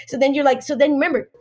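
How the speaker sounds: normal talking voice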